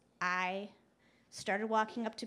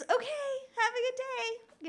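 high, sing-out polite farewell; falsely pleasant, high-pitched goodbye; outwardly cheery; 14 semitones higher, 4 dB louder than usual. Retold or imitated. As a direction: chirp it to cover annoyance. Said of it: "my outward response is".

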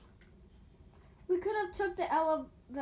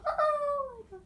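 high exclamation; 12 semitones higher, 4 dB louder than usual. Real, not acted. Failing the climb again.

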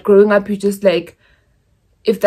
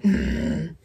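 ordinary talk

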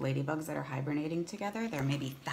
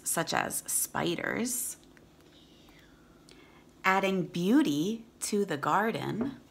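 clear, steady read-aloud voice; storybook reading for kids; reading to children; 3 semitones higher, 4 dB louder, slower than usual. Acted.

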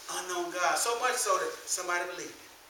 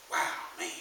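normal talking voice

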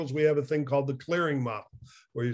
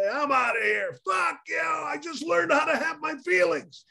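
much higher, hammed-up shouted rant; mock quitting rant; 12 semitones higher than usual. Quitting to the boss on Monday morning, mock shouted rant. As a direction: storm out on the boss, overdoing it.

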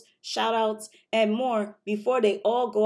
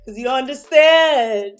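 loud, slow, stretched-out excitement; excited; 4 semitones higher, 5 dB louder, slower than usual. Real, not acted. Reactor hyping himself before the trailer, slow and loud. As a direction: savor every word of the hype. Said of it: "I'm excited".

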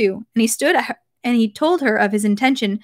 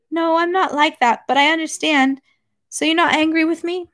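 high-voiced wife seeking reassurance; reassured wife; 7 semitones higher than usual. A narrator's reading. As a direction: ask in a higher, hopeful voice. Wife seeks reassurance from husband.

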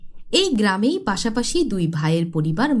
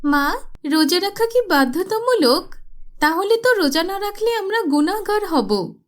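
put-on much higher little girl's voice; small child; 9 semitones higher than usual. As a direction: innocent child asking mother. Child asks mother. (মাকে প্রশ্ন করা ছোট মেয়ের উঁচু কণ্ঠ)

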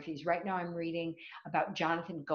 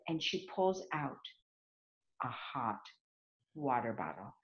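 much lower and softer, a hushed reveal; 8 semitones lower, 8 dB quieter than usual. A narrator's reading. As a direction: drop the voice for the big reveal. Climax of the story.

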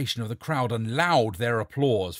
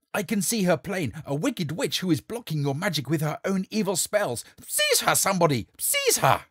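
frantic shouting; 8 semitones higher, 6 dB louder than usual. Acted. Charlatan accuses the witch.

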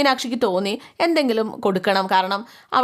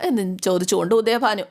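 ordinary delivery, nothing marked

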